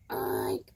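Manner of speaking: very low, drawn-out creaky oink; bird's oink attempt. Little bird trying out a song, long low oink. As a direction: grunt out one long oink. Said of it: "The little bird cried out".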